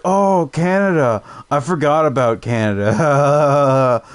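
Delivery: high, slow dopey voice; dim realization. Retold or imitated. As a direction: play the clueless guy finally getting it. Imitating the dim online stranger.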